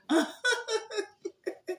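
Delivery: high-pitched burst of giggling; high giggle. Real, not acted. Reader laughs at her own pun to viewers, high giggle. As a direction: giggle at your own joke.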